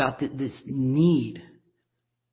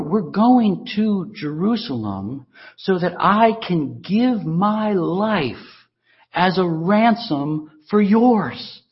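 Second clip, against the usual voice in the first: ordinary talk, nothing marked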